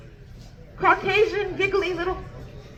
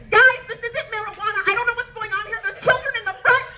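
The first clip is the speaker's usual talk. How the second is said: high, rushed, panicky rant; upset panic; 8 semitones higher than usual. Retold or imitated. Telling friends about the drugs she just saw, high panicked rant. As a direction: freak out to your friends in a high voice. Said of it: "I'm all upset".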